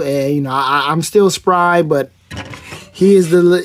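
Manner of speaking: normal talking voice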